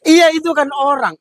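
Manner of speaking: high, loud exasperated cry; exasperated policeman. Acted. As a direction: throw up your hands at the driver. (polisi kesal, suara tinggi)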